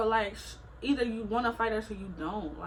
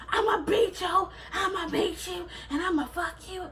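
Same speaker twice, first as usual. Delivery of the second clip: high, shouted imitation of a sudden threat; mock threat; 10 semitones higher than usual. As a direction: mimic her flipping to tough talk, yelling. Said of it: "like a light switch. She just switched".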